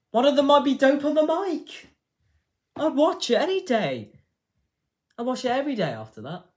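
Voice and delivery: high, loud, excited yelling; over-excited high-pitched outburst; excited hype. Real, not acted. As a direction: shout it in a high, hyped-up voice. Hyping an imagined rap battle.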